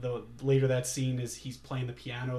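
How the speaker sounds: ordinary delivery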